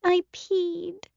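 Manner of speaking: put-on high, soft little-girl voice, ashamed; small child confession. A narrator's reading. Addie owning up to Caroline, small high ashamed voice. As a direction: whimper it out like a tiny guilty kid.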